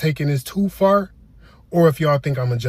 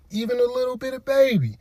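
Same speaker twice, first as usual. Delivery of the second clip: pitched way up, mock innocent; high squeaky aside; 9 semitones higher than usual. Sprinkling the ashes, in a squeaky mock-innocent voice. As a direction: squeak the punchline out.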